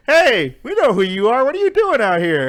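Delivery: loud, high challenge; mock confrontation. Rival group challenging the scammer out in space, mock confrontational shout.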